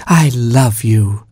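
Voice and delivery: slow, tender queen's voice; fondly. Acted. Queen cooing to her newborn chimp, slow and tender. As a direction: stretch it out lovingly. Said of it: "said the queen fondly".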